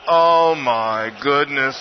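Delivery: low, slow, stunned gasp of words; hearing the warning, a low, slow stunned mutter; mock dismay. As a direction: let it sink in, dropped low. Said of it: "And I'm like".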